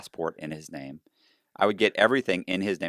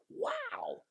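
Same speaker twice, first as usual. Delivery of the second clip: very high, hushed wow; awed customer; 15 dB quieter than usual. Retold or imitated. Client impressed by paid closing costs, hushed awe. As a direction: marvel softly in a high voice.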